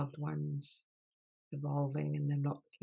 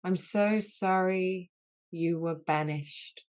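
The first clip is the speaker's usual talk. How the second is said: healing litany; 4 dB louder than usual. Acted. Leader voicing apologies to the wounded inner child, earnest and grave. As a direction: lead the group through each sorry with tender gravity.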